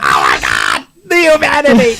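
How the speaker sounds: hammed-up old newsreel wail, half laughing; mock disaster announcer crying out; Hindenburg radio announcer. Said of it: "It's the Hindenburg all over again".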